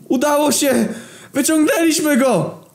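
very high, jubilant shouts; yelling like lunatics. Acted. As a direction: cheer wildly at the rescue. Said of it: "drąc mordy jak pojebani".

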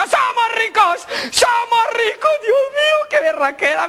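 very high, wild mock jubilation; hammed-up ecstatic cry; jubilant shouting. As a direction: shriek with joy at sudden riches. Husband discovers they are rich.

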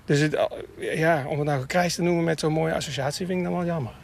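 normal talking voice

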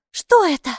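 sudden worry; anxious. A narrator's reading. Girl asks anxiously about the strange water, alarmed. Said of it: "с тревогой спросила Майки".

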